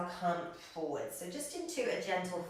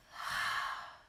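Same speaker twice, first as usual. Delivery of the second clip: breathy open-mouthed sigh out; slow demonstrated out-breath; long audible exhale. Shown as a demonstration. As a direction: let a deep breath out through the mouth.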